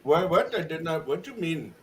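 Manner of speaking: usual speaking voice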